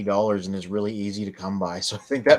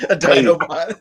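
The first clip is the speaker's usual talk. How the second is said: Dinobot voice; 6 semitones higher, 9 dB louder than usual. Pretend. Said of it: "That's very accurate".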